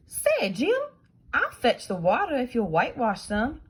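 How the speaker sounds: put-on high, loud eager boy voice; boy Tom pleading. Tom coaxing Jim to trade jobs, high eager boy. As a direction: play young Tom coaxing his friend.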